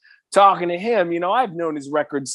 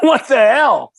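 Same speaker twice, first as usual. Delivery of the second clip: louder, higher disbelieving cry; disbelief; 8 semitones higher, 6 dB louder, slower than usual. Retold or imitated. Singer stunned by Carlos's praise, higher disbelieving cry. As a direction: exclaim it in shock.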